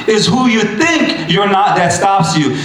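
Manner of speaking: ordinary talk, nothing marked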